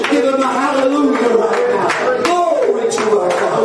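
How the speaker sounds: high, drawn-out revival scripture cry; full-force preaching. Real, not acted. Preacher rousing the congregation with Isaiah, soaring preaching. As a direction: build it into a soaring call to praise.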